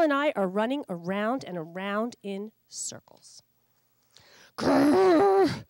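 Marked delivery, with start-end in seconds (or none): 4.6-5.6 loud, high exasperated growl; grumbling growl. Acted. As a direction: growl in frustration. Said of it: "Josh grumbles".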